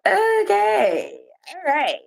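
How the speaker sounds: high, drawn-out doubtful okay; taken-aback acceptance. Retold or imitated. Woman reacts to his tepid answer, high and sceptical. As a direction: back off with a high-pitched okay.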